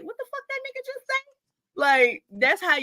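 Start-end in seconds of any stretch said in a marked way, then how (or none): 0.0-1.1 high, fast bewildered question; sudden high puzzled voice; confused thought. Retold or imitated. Classmates baffled by polite cussing, high and fast. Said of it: "you be thinking like".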